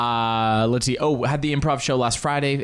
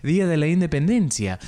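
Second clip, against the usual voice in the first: higher, lilting Argentine Spanish; Argentine-leaning Spanish accent; 5 semitones higher than usual. Shown as a demonstration. Host replays his slip for listeners, Argentine-leaning accent. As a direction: let the Spanish drift south toward Argentine. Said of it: "un poco más argentino".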